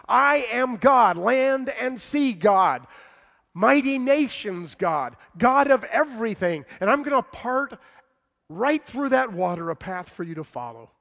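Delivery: God declaring his might to the people; proclaiming. Acted. Preaching God's voice at full force.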